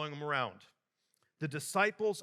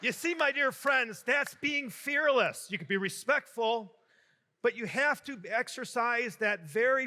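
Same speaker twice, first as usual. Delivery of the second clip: raised, high-pitched emphatic homily delivery; preaching; 4 semitones higher, 4 dB louder than usual. Real, not acted. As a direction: drive the moral home to the congregation, pitch lifted. Moral preached after the joke.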